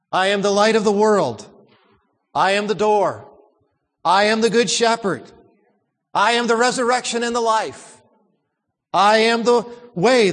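I am statements building in force; building preaching. Acted. Preacher proclaiming the I am sayings to his church. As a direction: build each I am a little stronger.

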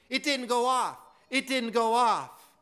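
loud, emphatic mock scream; retold scream. Preacher retelling the would-be assassin's outburst, retold scream. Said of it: "She began screaming".

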